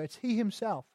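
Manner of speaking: soft but pointed HE; stress on HE. Shown as a demonstration. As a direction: quietly insist on "he" in "he himself". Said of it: "That's the emphasis".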